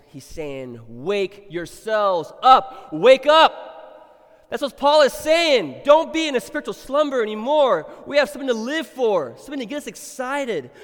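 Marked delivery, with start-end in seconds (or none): 0.9-4.4 loud, high, slow preaching call; preacher crying out for the church to wake up; rousing shout. Acted. 4.5-10.7 loud high exhortation, slowing on don't; fiery preaching. Acted. Preaching at full force.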